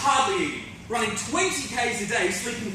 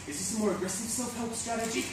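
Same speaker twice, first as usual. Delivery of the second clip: hushed, softer aside; whispering; 7 dB quieter than usual. Acted. Agent to client at the mirror, hushed whisper. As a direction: drop the volume, confidential. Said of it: "my agent whispers".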